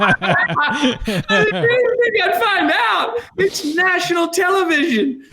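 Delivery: high, slow shout through laughter; laughing shout. Retold or imitated. Son retelling his reply to mom about national television, laughing high shout. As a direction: yell it out, cracking up in disbelief.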